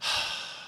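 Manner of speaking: long voiceless breath out; exhale after drinking. Retold or imitated. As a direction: act out the exhale after a warm swallow. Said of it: "the exhale".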